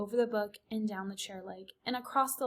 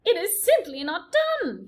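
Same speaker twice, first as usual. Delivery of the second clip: prim Aunt Florence; 9 semitones higher, 7 dB louder than usual. A narrator's reading. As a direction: sniff it out like a scandalized aunt. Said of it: "came Aunt Florence's voice".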